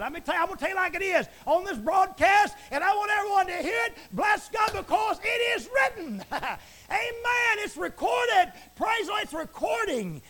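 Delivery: high, loud, full-force preaching; shouting preacher. Acted. Preacher condemning idol gods to his listeners, shouting. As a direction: thunder the commandment at the congregation.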